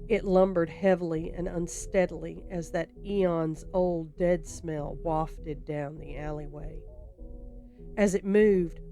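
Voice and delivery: usual speaking voice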